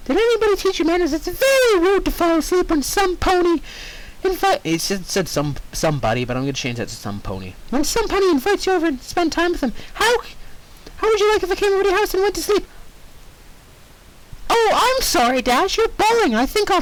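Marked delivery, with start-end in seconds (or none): 0.1-4.6 high, fast scolding pony voice; high, quick pony telling off; frustrated Pinkie. Acted. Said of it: "Frustrated Pinkie". 7.7-12.7 high, fast scolding pony voice; high, quick pony telling off; frustrated Pinkie. Acted. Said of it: "Frustrated Pinkie".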